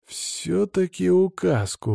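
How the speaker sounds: slow, drawn-out resigned line; sighed concession. A narrator's reading. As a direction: say it slowly with a sigh in the voice. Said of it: "вздохнул я". (со вздохом, протяжно)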